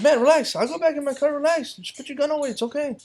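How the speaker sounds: high-pitched urgent placating; acted-out high, pressing reassurance; retold pleading. Pleading with screaming cop.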